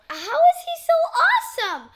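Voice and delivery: high, loud fangirl squeal; excited gushing. Real, not acted. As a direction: gush about the star. Gushing about star.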